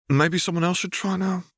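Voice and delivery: put-on high, pleading boy's voice; a kid begging to be let off the hook; plaintive. A narrator's reading. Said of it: "Obi-Wan says plaintively".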